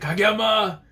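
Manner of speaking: high, drawn-out name call; hammed-up excited call; acted calling out. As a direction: call the name across a crowd. Acting out a character calling Kageyama.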